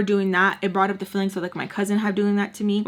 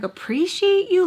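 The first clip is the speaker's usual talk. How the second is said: high-pitched incredulous echo; mad disbelief; 10 semitones higher, 3 dB louder than usual. Retold or imitated. Girl retorting to the boy's complaint, incredulous. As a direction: throw it back, pitched way up, outraged. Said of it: "I'm getting mad at this point".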